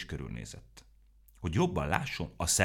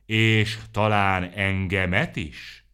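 slow, drawn-out, louder dramatic question; 3 semitones higher, 9 dB louder, much slower than usual. A narrator's reading. Aromó dramatically asking if Serafin outruns him too, slow. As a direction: stretch it for dramatic effect. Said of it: "drámai hangon".

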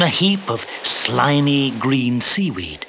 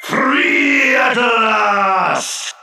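high, slow, drawn-out genie shout; thunderous boom; 6 semitones higher, 4 dB louder, much slower than usual. A narrator's reading. Genie bursts free of the bottle, booming. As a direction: boom it out louder than thunder. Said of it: "boomed a voice louder than thunder".

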